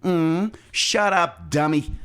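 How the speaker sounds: put-on slow, drawn-out Valley Girl voice; lightly voiced airhead Valley Girl. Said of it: "I'm just doing a Valley Girl voice".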